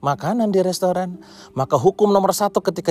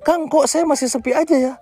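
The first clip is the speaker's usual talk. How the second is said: clueless owner; 7 semitones higher than usual. Retold or imitated. Owner whines to coach that his restaurant is still empty, high and whiny. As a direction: whine like a lost owner who understands nothing.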